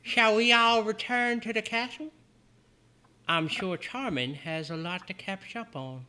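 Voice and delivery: put-on sweet high older woman; Calypso voice. GM voicing Calypso.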